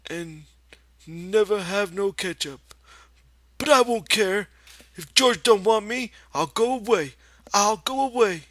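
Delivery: put-on high, loud, plaintive childlike voice; a big child feeling sorry for himself; sad Lenny. A narrator's reading. Lenny, alone and sulking, threatens to leave George, high and plaintive. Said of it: "And he continued sadly".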